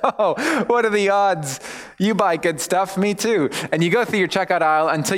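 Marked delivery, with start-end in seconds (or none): 0.0-3.5 high, slow awkward cheer; forced chit chat. Retold or imitated. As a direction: strain for small talk.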